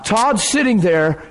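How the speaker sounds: ordinary talk, nothing marked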